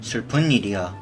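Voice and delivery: ordinary delivery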